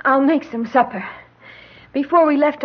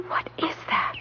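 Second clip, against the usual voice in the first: hushed, wary question; soft, unvoiced wondering; fearful whisper; 10 dB quieter than usual. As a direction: whisper it as you listen. Wife hears mysterious sobbing, alone.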